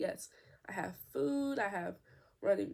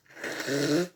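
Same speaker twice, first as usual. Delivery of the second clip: real laugh, breath running out; breathless laugh; 5 semitones lower, 9 dB louder than usual. Real, not acted. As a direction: laugh until you can't breathe. Laughing fit while recording.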